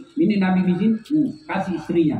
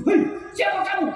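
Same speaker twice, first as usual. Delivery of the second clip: high, shouted alarm; startled husband yells at the jinn; 9 semitones higher than usual. Acted. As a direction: cry out in shock at the monster. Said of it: "Kaget suami".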